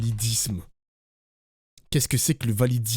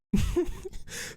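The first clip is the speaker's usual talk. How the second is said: breathless giggle; 7 dB quieter than usual. Real, not acted. Streamer giggling at chat.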